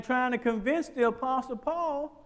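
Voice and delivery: ordinary delivery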